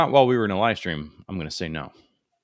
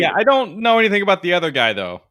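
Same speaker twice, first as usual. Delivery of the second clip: much higher and louder goofy voice; silly voter voice; 7 semitones higher, 9 dB louder than usual. Retold or imitated. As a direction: play along as a clueless voter. Joining the mock voter bit.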